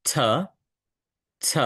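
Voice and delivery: "th" as a short aspirated t, not a lisped th; t sound. Shown as a demonstration. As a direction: put the tongue tip behind the upper teeth and release "th" with air. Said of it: "জিহ্বার সামনের অংশটা".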